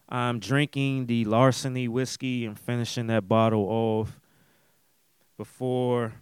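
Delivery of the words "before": word broken by a burp. Real, not acted. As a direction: burp slips out mid-word. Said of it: "mm, excuse me, first burp".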